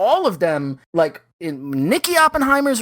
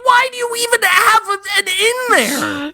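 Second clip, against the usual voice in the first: incredulous shout; 13 semitones higher, slower than usual. Real, not acted. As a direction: cry out in mock disbelief. Mocking outrage at the photo.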